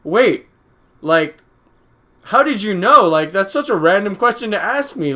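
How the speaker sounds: high, loud startled "wait, how did you know"; mock-shocked disbelief, loud and high; retold surprise. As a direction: jump up in pitch, caught off guard. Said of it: "And I was just like".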